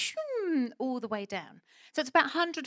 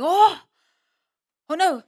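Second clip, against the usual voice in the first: alarmed cry; 7 semitones higher, 15 dB louder, slower than usual. Retold or imitated. Locals gasp at the collapsing slide.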